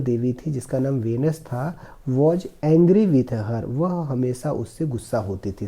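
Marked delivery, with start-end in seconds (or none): 2.1-3.6 slow, careful reading of the English passage; slow reading aloud. Shown as a demonstration. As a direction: read each word slowly for the class. Teacher reads story slowly. (धीमी आवाज़ में अंग्रेज़ी पढ़ना)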